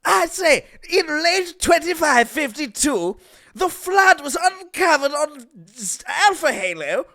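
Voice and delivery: high, slow pompous Englishman; posh British voice. Pretend. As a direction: narrate like a stuffy British gent. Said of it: "in that voice".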